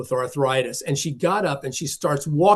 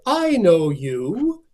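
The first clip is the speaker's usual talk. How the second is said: theatrical voice; 4 semitones higher, 3 dB louder, much slower than usual. Retold or imitated. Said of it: "her voice was almost like she had studied in the theater".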